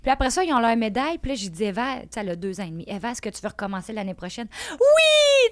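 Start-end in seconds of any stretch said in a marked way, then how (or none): 4.7-5.5 put-on a high, loud, delighted child's yes; a little girl's excited shout of yes; eager toddler yes. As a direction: squeal it like a thrilled two-year-old. Toddler's eager answer quoted.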